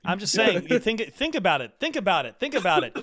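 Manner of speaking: insistent. Real, not acted. Insisting to friends.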